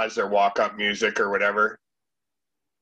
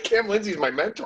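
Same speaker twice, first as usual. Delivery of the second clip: high-pitched jokey boast; playful brag, pitched high; mock name-dropping; 9 semitones higher than usual. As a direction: drop the name with pretend importance, voice pitched up. Continues the joke name-drop boast.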